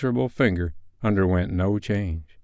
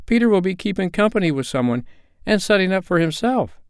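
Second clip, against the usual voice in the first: put-on high-pitched, eager girl's voice; excited girl; 10 semitones higher than usual. A narrator's reading. A daughter teases Peter about his future, excited and high. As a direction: chatter it high, like a teasing sister. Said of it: "cried one of the girls".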